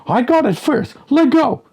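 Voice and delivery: put-on squabbling kid. Kids arguing over pole.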